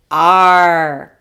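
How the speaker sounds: loud, drawn-out pirate "Arr". Acted. Teacher models the sound of A R, pirate "Arr". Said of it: "remember the sound of a pirate".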